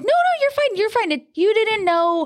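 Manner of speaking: quick reassurance. Retold or imitated. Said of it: "And I'm like".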